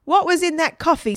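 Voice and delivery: high, loud irate demand; very angry. A narrator's reading. Dad to the children the next morning, angry demand. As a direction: demand an answer crossly. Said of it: "Dad was very angry".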